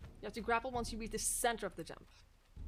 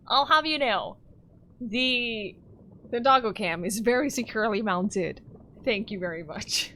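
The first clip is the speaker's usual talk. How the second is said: mock indignant; 11 dB louder than usual. Mock indignant at chat remark.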